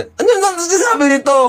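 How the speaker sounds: laughing in disbelief, voice cracking high; half-laughing, half-crying at what he said; exasperated laugh. Real, not acted. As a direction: break into a helpless laugh at the nonsense. Baffled by Saldico's answer.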